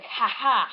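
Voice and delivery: loud two-beat "ha ha"; knight's hammed-up cackle; villain's mock laugh. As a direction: fake gloating laugh, punched out. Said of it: "one of the knights is like".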